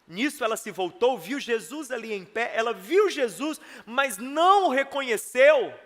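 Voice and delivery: ordinary delivery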